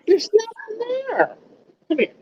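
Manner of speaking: very high coaxing voice; high-pitched talk to an animal; pet talk. Real, not acted. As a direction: reassure a pet in a high sing-song. Talking to a pet.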